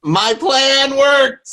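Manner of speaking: high, drawn-out gloating cheer; triumphant. Real, not acted. Player celebrating a trap that worked, high triumphant crow.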